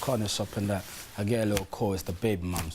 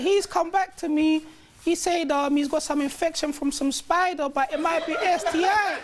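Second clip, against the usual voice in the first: much higher whiny girlfriend impression; mock baby mum voice. Mocking the baby mum's nagging in a high voice. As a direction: pitch way up to mock her nagging.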